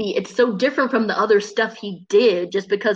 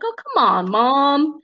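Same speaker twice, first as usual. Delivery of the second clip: put-on slightly higher, coaxing kid; lightly voiced Bruno; 5 semitones higher, slower than usual. Mouse son cheering up his upset mom, light coaxing voice. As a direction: coax mom out of her upset. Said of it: "And he's like".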